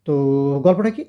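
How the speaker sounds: ordinary delivery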